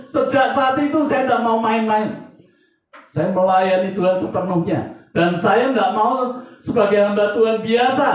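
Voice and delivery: high, loud pulpit proclamation; impassioned preaching. Real, not acted. Preacher vows to serve God wholeheartedly, preaching with full force. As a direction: declare it forcefully to the congregation.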